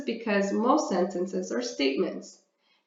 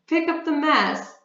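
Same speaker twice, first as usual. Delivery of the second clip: firm, raised order to tidy up; commanding; 7 semitones higher, 3 dB louder than usual. Shown as a demonstration. Teacher demonstrates a command sentence, firm and raised. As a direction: bark it like a real command. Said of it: "This is a command".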